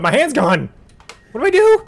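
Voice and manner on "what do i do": very high, loud panicked cry; lost and frantic; panicked question. Real, not acted. Hand cut off in game.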